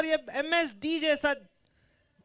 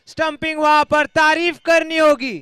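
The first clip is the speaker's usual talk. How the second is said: rising, louder call of a stumping; excited cricket commentary; 5 semitones higher, 8 dB louder than usual. Real, not acted. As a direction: build up to a loud, higher cheer. (Stumping पर जोश से बढ़ती आवाज़)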